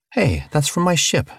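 sudden high burst of surprise; blurted out. Acted. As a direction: blurt it before thinking. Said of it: "Charlie blurted".

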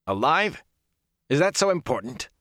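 high-pitched indignant retort; sharply rising, incredulous anger; angry. A narrator's reading. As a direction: throw the question back angrily. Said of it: "Ingtar's face was no longer angry".

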